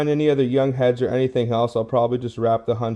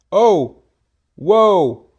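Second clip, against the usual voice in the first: high, loud whoa of surprise; shrieking jackpot winner; 7 semitones higher, 7 dB louder than usual. Real, not acted. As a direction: yelp as you spot the prize. Spotting a find.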